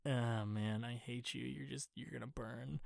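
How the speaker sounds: very soft, low imitation of hatred; muttered resentment. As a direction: mutter it under your breath. Voicing Connor's muttered hatred of villain.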